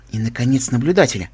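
ordinary delivery

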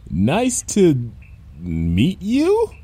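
halting, rising greeting; wary politeness. A narrator's reading. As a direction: greet with nervous uncertainty. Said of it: "said in a tone of voice".

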